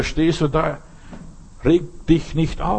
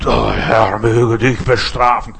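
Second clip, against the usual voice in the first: stern curse; 4 semitones lower, 5 dB louder, slower than usual. Acted. Preacher voices a harsh curse.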